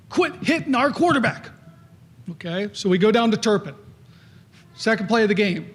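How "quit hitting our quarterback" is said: stern coach. Retold or imitated. Coach scolds player in practice.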